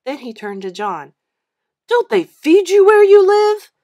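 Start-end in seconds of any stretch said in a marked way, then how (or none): 1.9-3.7 high, loud sneer; mock him from above, loud; bully sneering at a smaller boy. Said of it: "he sneered".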